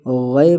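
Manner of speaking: "غے" ending in open e; majhool vowel. Shown as a demonstration. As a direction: say "غے" as gha-e. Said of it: "غے نہیں". ("غے" مجہول آواز میں)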